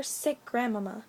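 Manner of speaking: usual speaking voice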